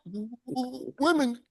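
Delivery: hammed-up shaky, choked-up delivery; imitated cracking voice. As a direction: stammer it softly as if the voice is cracking. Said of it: "hear the cracking in his voice".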